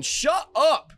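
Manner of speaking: high, loud two-word outburst; sudden shout. Real, not acted. Host silencing someone mid-story, sudden shout. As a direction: shout it sharply over an interruption.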